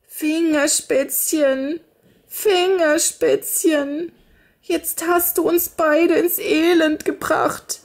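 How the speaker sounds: high, slow lamenting voice; wailing. A narrator's reading. Frau jammert über das Mädchen, hoch und klagend. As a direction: wail the name in despair. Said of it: "jammerte die Frau".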